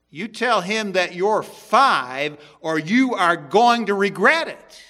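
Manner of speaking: slow, loud, menacing "You tell him that you're five"; stern threat. Acted. Mother threatening her son who refuses to lie, stern and slow. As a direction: warn him slowly, every word weighted. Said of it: "But Mama, I'm six".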